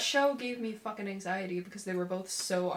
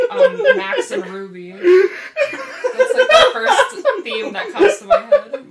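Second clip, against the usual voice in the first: giggling through the words; high, loud laughter breaking through; laughing; 13 dB louder than usual. Real, not acted. Giggling while naming the show.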